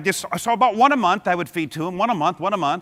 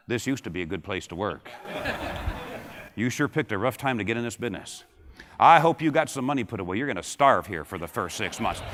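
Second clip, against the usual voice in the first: softer, lower gloomy grumble; gloomy complainers; 10 semitones lower, 7 dB quieter than usual. Retold or imitated. Negative veterans greeting a new hire, softer, lower gloomy grumble. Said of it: "they're gonna say".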